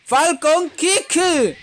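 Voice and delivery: high, loud, drawn-out move call; attack shout. Acted. Gamer shouting Falcon Kick as he lands it. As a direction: stretch the move name like an anime yell.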